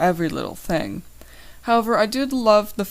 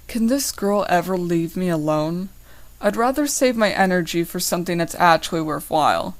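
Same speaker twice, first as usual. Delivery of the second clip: ordinary delivery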